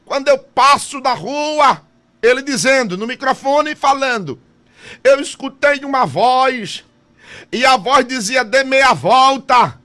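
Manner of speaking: loud, high preaching with shouts; fiery preaching. Acted. Pastor preaching loudly to the congregation. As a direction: preach it out to the church with full force.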